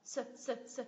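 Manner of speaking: "S" as a soft, unstressed suh; schwa. Shown as a demonstration. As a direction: say each "s" weakly. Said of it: "không được nhấn là xoa sao".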